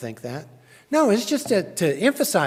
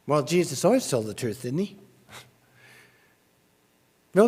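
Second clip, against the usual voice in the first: ordinary delivery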